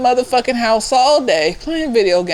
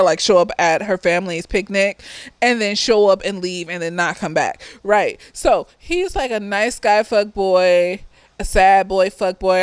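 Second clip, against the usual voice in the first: ordinary delivery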